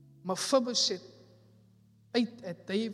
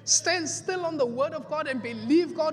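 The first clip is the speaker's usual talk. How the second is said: higher, louder, driving sermon cadence; fiery pulpit exhortation; preaching at full force; 6 semitones higher, 5 dB louder than usual. Acted. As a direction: exhort the church, building each line.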